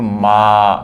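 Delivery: मा drawn out slowly on a level note; long vowel. Shown as a demonstration. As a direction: stretch मा well beyond normal length. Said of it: "काफ़ी long sound है". (मा को खूब लंबा खींचकर)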